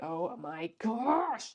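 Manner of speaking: slow, soft, fed-up groan of words; exasperated sigh. Real, not acted. Reviewer fed up with the couple, slow soft exasperation. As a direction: sigh it out in despair.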